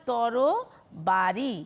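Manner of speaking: "তরবারি" stretched, every syllable drawn out slowly. Shown as a demonstration. Teacher repeating the example word very slowly for students. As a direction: draw out তরবারি so each syllable can be heard. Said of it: "কি বললাম?".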